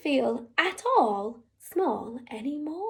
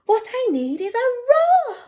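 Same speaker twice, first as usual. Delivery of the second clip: excited little mouse; 9 semitones higher, 6 dB louder than usual. Acted. Mouse jumps up with idea.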